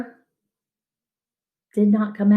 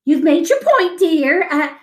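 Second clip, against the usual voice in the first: scolding; 8 semitones higher than usual. A narrator's reading. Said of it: "she scolded him".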